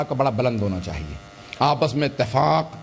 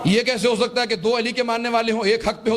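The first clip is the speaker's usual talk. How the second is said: high, insistent exhortation; rousing preaching; 9 semitones higher than usual. Real, not acted. Preacher challenging feuding believers, rousing preaching. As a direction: drive the point home on a raised pitch.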